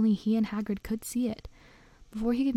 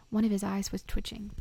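plain reading voice

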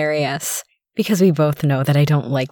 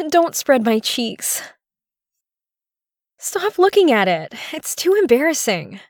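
high-pitched, urgent protest; flustered pleading; 6 semitones higher than usual. Acted. Woman begs her partner to stop, flustered and high. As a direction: plead and object, pitch raised.

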